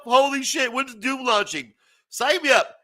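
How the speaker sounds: high, giddy gamer eagerness; mock hype. Gamer mocking his own Doom hype, high and giddy. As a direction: act out begging to play Doom.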